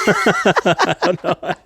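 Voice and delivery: breaks up laughing; real laugh while talking. Real, not acted. Laughing at flustered dad memory.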